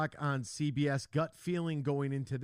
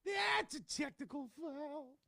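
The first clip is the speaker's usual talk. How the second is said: referee call; 12 semitones higher, 5 dB quieter, slower than usual. Retold or imitated.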